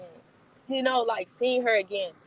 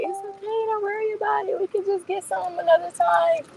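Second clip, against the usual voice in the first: imitating friend's comfort; 10 semitones higher than usual. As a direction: gently talk her out of it in a higher voice. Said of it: "She was just like".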